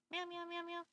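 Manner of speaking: soft high meows; nervous cat voice. Pretend. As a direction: meow quietly like a shy cat. Meowing as cat.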